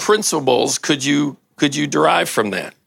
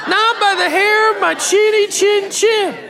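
very high, piping pig voice; squeaky little pig; 4 dB louder than usual. Acted. Preacher voicing the little pig refusing the wolf, very high and squeaky. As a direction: squeak it out like a tiny scared pig.

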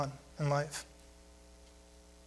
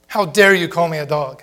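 louder, offended "how dare you"; indignant retort; 8 dB louder than usual. Pretend. Woman offended by the Lord, an indignant louder retort. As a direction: play the insulted woman snapping back at the Lord. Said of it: "Is that what she says? No".

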